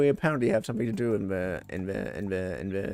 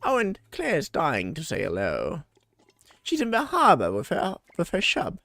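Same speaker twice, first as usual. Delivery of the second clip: doting home cook; 7 semitones higher than usual. Acted. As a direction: voice a fond villager, pitch swinging up high. Same cook character talking to Kai.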